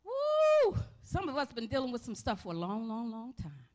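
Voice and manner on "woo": high, loud joyful whoop; excited woo. Real, not acted. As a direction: let out a spirited whoop. Preacher exclaiming mid-sermon.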